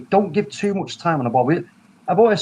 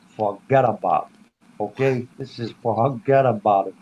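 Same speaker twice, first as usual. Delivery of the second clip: slow, lowered mock New York voice; New York accent; 3 semitones lower, slower than usual. Joking to co-hosts about Haaland at Manchester United, New York accent. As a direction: put on a slow, low New York drawl. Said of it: "in my New York voice".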